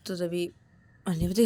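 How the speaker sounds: plain reading voice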